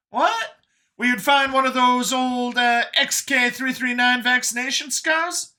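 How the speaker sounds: very high surprise easing into fond description; Brian, puzzled then admiring. Acted. Brian describing Catherine to Vincent, high and animated. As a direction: start high and baffled, then ease off.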